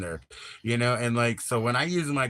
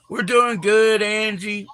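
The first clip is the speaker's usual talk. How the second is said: much higher and louder, bubbly; excited reply; 12 semitones higher, 11 dB louder, slower than usual. Real, not acted. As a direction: beam back at a friend. Answering viewer greeting excitedly.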